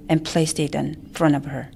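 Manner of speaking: ordinary delivery, nothing marked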